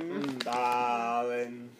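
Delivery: very slow, low "darling"; low, lingering purr on "darling"; drawn-out darling. Shown as a demonstration. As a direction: stretch "darling" right out, low and soft.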